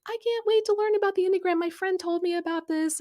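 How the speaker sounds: high, fast, eager gushing; gushing excitement. Retold or imitated. Acquaintance raving about her new Enneagram discovery, gushing excitedly. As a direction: imitate someone bubbling over with enthusiasm.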